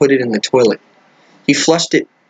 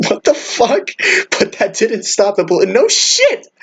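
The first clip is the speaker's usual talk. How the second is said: high-pitched incredulous laughing outburst; laughing in disbelief; 8 semitones higher, slower than usual. Real, not acted. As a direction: crack up while reading, voice shooting up. Reader cracks up at the story.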